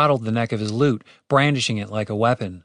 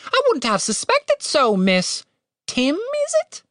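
very high, polite bird voice; courteous little bird, hopeful lift; hopeful talking bird; slower than usual. A narrator's reading. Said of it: "the bird asked hopefully".

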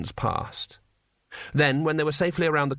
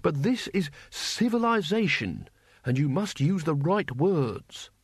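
measured, slightly higher schoolmasterly correction; patient civilized teacher; 4 semitones higher, slower than usual. Acted. Mister Saveloy corrects a warrior's language, patient and insistent. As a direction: stretch key words in polite insistence. Teacher figure lecturing the horde.

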